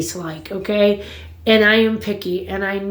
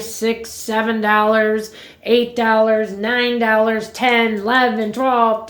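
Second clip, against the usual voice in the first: ordinary delivery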